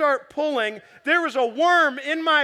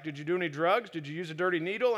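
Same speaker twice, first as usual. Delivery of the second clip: questioning doctor; 6 semitones lower, 5 dB quieter, faster than usual. Retold or imitated. Voices doctor questioning him.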